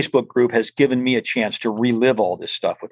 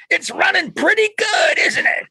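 excited shout. Retold or imitated. Old racer shouting from the car, high thrilled yell. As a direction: call it out, delighted with the car's speed.

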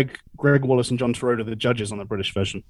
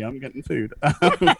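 ordinary talk

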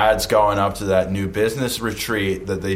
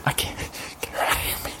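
hushed; 8 dB quieter, faster than usual. Acted. Hushed panic.

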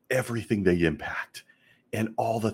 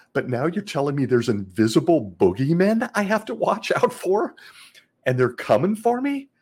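incredulous, climbing high and louder; alarmed kid; 7 semitones higher, 5 dB louder than usual. Acted. A kid reacting to talk of invisible boogeymen, alarmed disbelief. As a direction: build to a high, panicky question. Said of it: "I was a kid".

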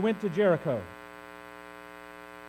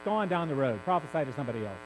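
lower, brushing-off voice; impatient Elijah; 7 semitones lower than usual. Acted. Elijah telling the prophets to move on, low and impatient. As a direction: wave the prophets away, tired of hearing it. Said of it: "he's like".

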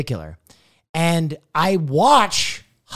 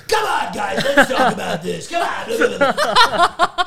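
high-pitched mock host rallying everyone; Austin Show; 9 semitones higher, 3 dB louder, slower than usual. Streamer still doing content for the group, hammy and high. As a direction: imitate an over-excited streamer playing to the audience. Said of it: "Austin is still doing content. He's like".

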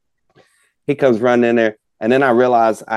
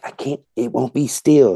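lightly voiced child, higher pitch; little girl; 5 semitones higher than usual. Daughter protesting that the gobbler moves, child's complaint. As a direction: imitate a kid's mild protest. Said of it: "And she's like".

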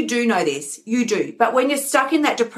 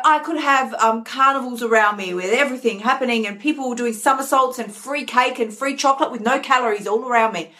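ordinary delivery

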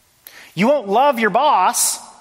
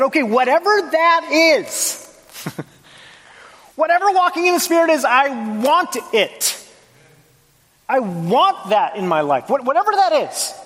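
much higher, eager and pleading, with a laugh; eager longing; 7 semitones higher than usual. Retold or imitated. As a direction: voice your past self desperate for it, almost giddy. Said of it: "and I said".

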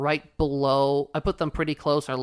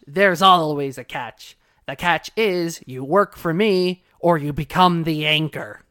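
slow, louder pirate captain; 5 dB louder, slower than usual. Retold or imitated. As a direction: menace them like a pirate.

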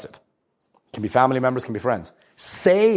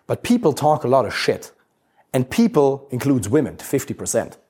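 usual speaking voice

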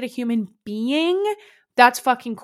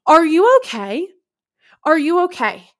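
shocked concern; 5 semitones higher, 6 dB louder than usual. Real, not acted. Reacting to shocking story.

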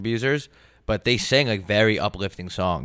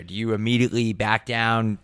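normal talking voice

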